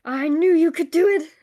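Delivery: loud, happy cheer; proud friend cheering you on; excited. Acted. GM voices NPC cheering friend.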